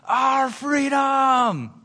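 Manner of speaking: stretched rallying yell of freedom; yelled battle cry. Retold or imitated. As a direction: bellow it to the whole army. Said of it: "and then he yells it".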